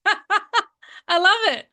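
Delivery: laughing. Real, not acted. Laughing at guest's quip.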